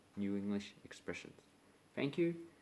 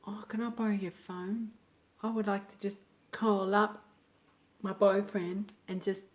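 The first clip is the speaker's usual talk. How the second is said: higher, sweet asking voice; pleading borrower; 9 semitones higher, 3 dB louder than usual. Retold or imitated. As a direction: play the innocent stranger asking a favour. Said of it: "someone goes".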